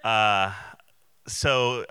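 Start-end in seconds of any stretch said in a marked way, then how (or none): none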